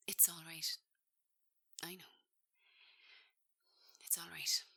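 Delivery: soft breathy whisper of comfort; whispered reassurance; gentle whisper. Acted. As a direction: hush him tenderly. Nina comforting Jack.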